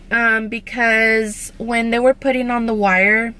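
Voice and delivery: speaking around tooth brackets; careful lips over braces; talking with new braces. Real, not acted. Seen 0: metal brackets visible on her teeth at +2.9 and +4.1.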